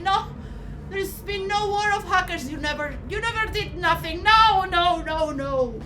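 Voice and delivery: loud, high, heated protest; furious denial. Acted. Philosopher shouting down his accusers in angry denial. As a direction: fight back hard against the charge.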